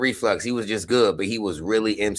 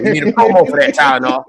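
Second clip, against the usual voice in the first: mock promo; 4 semitones higher, 5 dB louder than usual. Joking about a Tylenol ad, hyped mock promo. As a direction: sell it like a cheesy commercial.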